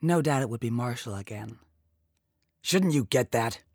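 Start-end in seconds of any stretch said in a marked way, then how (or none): 2.6-3.6 loud, curt demand from an irritated man; irritable snap. A narrator's reading. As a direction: snap it out, louder than usual. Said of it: "Brewster snapped".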